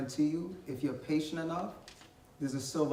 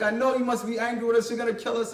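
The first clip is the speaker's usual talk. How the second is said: frightened brothers; 6 semitones higher, 5 dB louder, faster than usual. Retold or imitated. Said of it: "and they're like".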